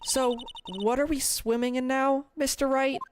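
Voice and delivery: put-on high-pitched girl voice; put-on high girlish voice. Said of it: "back to the girl voice".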